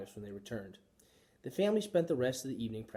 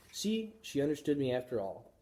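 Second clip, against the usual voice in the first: ordinary delivery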